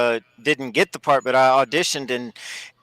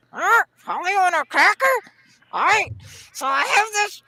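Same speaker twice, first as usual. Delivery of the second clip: high squawky parrot voice. Pretend. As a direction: talk like a pet parrot, pitched way up. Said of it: "how I ended up starting the voice".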